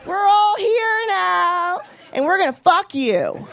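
high, very slow triumphant drawl; high slow gloating arrival. Retold or imitated. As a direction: savor the victory. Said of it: "they go to heaven like".

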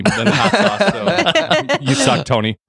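laughing through the words. Real, not acted. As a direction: tease while cracking up. Laughing while teasing Tony.

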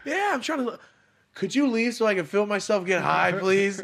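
loud, high-pitched comic pleading; mock exasperated shout. Asking film crew to leave, mock exasperated. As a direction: plead loudly, fed up, for effect.

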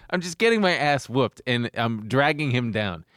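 usual speaking voice

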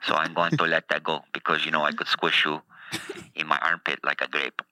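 lightly put-on Russian accent. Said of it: "I went to a Russian accent there".

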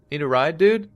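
high, bright, loud offer to a stranger; eager friendly call. Retold or imitated. Narrator voices himself offering ride.

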